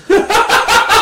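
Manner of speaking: big high burst of laughter; loud laugh. Real, not acted. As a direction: crack up loudly. Host cracks up.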